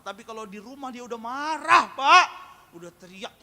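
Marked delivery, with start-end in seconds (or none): 0.0-2.3 building outburst. Retold or imitated. Preacher acting out a husband complaining about his wife, building to a shout. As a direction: rise to a high, loud yell.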